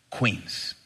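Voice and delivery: usual speaking voice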